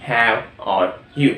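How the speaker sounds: How are you drawn out very slowly; teacher modelling the question word by word; slow, clear classroom question; slow model question. Shown as a demonstration. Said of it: "فبقول".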